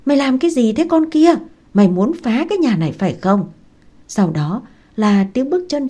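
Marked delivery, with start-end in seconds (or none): 0.1-3.5 higher-pitched scolding call from downstairs; calling up angrily. A narrator's reading. Sister-in-law calls up after hearing the tray crash, angry call. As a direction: shout up at her, acted, not full force. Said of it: "nói vọng lên".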